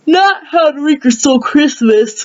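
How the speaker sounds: speaking through a yawn; sleepy yawning delivery. Real, not acted. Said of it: "sorry I'm yawning so much".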